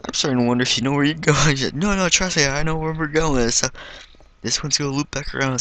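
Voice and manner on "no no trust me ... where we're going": high, insistent mock reassurance; insistent friend. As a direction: imitate her overconfident no no. Friend insists she knows way.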